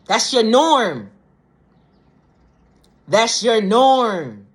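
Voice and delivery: slow, loud and high, stretching each word; drawn-out realisation. Real, not acted. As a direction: draw out NORM long and falling.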